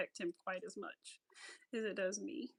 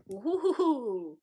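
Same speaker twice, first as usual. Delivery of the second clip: ordinary delivery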